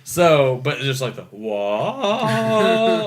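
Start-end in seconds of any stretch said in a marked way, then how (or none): 1.2-3.1 long rising "whoa"; imitated transformation cry. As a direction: swell upward on one vowel.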